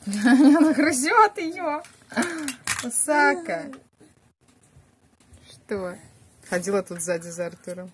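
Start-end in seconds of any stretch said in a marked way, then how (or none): 0.1-3.7 laughing while talking. Real, not acted. Laughing at puppy chewing.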